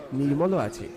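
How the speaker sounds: usual speaking voice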